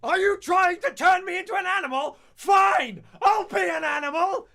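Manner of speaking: screamed way up high and loud; a teacher losing it at the class, shouting; furious shout. Retold or imitated. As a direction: rage like a teacher who's snapped. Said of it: "He then proceeded to shout".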